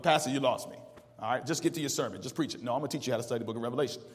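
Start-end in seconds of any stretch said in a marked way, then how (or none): 0.0-2.6 lower, softer, quick mock listener; lightly voiced fed-up churchgoer; impatient churchgoer. Imitating a listener telling the pastor, lower and quick. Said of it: "I'm like".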